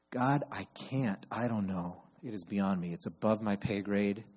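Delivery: calm surrender. Shown as a demonstration. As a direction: let go and hand it over quietly. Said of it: "settle into".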